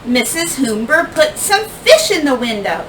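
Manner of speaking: ordinary delivery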